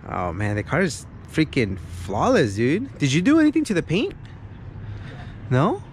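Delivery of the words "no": normal talking voice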